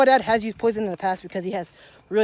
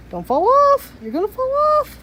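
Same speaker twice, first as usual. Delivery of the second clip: much higher, cooing warning; 5 dB louder than usual. Real, not acted. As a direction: sing it up high to the kitty. Warning the cat.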